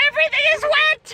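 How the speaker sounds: high, laughing yell; high laughing cry about being soaked; laughing shout. Real, not acted. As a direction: shout it while cracking up. Laughing complaint at the rain.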